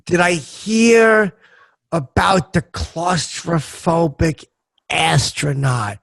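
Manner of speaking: very slow, drawn-out echo of the setup; deliberate joke guessing. Real, not acted. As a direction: stretch it out while working out the punchline. Drawn-out guess at joke's punchline.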